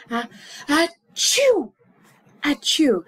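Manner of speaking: loud, high hammed-up achoo; mock sneeze. Shown as a demonstration. To students, demonstrating what a sneeze sounds like, loud mock sneeze. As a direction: fake a big sneeze. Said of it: "you say".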